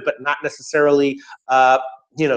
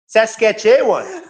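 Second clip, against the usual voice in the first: Saskatchewan in a drawn-out, high mock Canadian accent; put-on Canadian accent, slow and pitched up; mock Canadian pronunciation; 7 semitones higher, much slower than usual. Shown as a demonstration. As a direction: stretch out Saskatchewan like an exaggerated Canadian. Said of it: "the actual way Canadians pronounce it".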